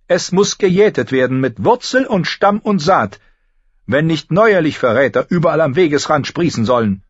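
high, loud solemn decree; solemn maester. A narrator's reading. Grand Maester Pycelle declares treason a weed, solemnly. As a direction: pronounce it like scripture. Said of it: "erklärte Pycelle feierlich".

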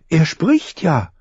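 put-on high, slow, astonished girl; wide-eyed with wonder, slowly; amazed girl. A narrator's reading. Girl amazed at talking raven.

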